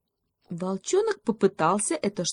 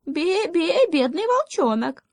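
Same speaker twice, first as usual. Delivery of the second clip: very high little-goat voice; bleating pity; 12 semitones higher, 4 dB louder, slower than usual. Acted. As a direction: bleat out mock sympathy. Козлёнок подыгрывает, жалеет волчонка. (жалостливое высокое блеяние козлёнка)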